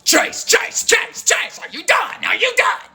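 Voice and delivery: hissed names then high shouted are you done; impatient calling. Retold or imitated. Friend calling through the bathroom door, impatient. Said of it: "She's like".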